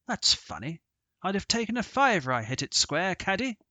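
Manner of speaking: a touch of indignant disbelief; puzzled gentleman. Acted. Sir Harry puzzled by shot.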